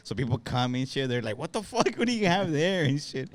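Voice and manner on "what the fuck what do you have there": high-pitched shocked disbelief. Retold or imitated. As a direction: react in disbelief at the screen. Coworkers reacting to the wallpaper.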